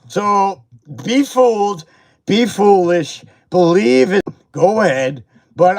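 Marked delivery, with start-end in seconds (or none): none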